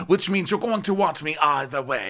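high, hammed-up pushy coaching voice, building to a shout; mock bossy pep talk. Friend coaching her before the meeting, hammed-up high bossy voice. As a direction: egg her on in a shrill put-on voice that ends shouting. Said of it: "So she's like".

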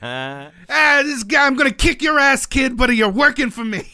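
loud, high gruff Brooklyn voice; Brooklyn accent. Retold or imitated. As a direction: bark it like a tough Brooklyn boss. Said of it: "in his thick Brooklyn accent".

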